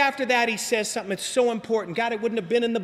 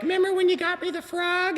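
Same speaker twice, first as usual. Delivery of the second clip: higher, fond mom voice; imitating mom; 7 semitones higher than usual. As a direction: put on his mother asking sweetly. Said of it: "she's always like".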